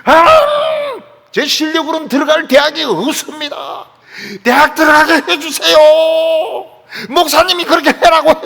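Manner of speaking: imitating a student's high, shouted prayer; loud, desperate student bellowing a prayer; yelling. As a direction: holler the plea to God at full voice. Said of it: "학생은 진짜 소리를 질렀어요".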